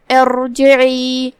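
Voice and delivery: ارجعی slow, with a clear opening hamza, a heavy ر and the ع from mid-throat; drawn-out ارجعی with a full ر. Shown as a demonstration. Teacher shows the word, said slowly with a heavy ر. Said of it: "تو ہم یہ کلمہ کو ایسے ادا کریں گے".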